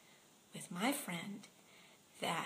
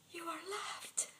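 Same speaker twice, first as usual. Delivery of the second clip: tender whisper; 7 semitones higher, 6 dB quieter than usual. Retold or imitated. Comforting ranting man, face in hands.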